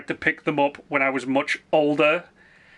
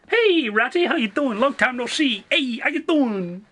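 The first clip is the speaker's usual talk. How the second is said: high, playful mock-Italian hello; Italian accent; 8 semitones higher than usual. Welcoming the raider Ratty, in a high Italian accent. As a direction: greet an old pal in a hammed-up Italian accent. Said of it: "Why do I keep going Italian?".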